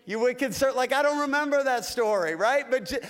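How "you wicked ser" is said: playful hammed-up rebuke; mock stern Jesus. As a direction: scold like a stern teacher. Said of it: "Jesus saying to me".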